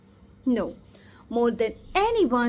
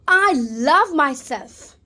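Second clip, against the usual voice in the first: louder, slow and firm; loud affirmation; 6 dB louder, slower than usual. Shown as a demonstration. Coach leading the audience in an affirmation, loud and slow. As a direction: proclaim it so the whole room hears. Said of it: "repeat after me loudly".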